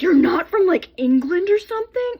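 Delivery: high, loud, astonished question; amazed classmate. Retold or imitated. Classmate asking if she's from England, high and amazed. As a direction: sound stunned she might be English. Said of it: "she's like".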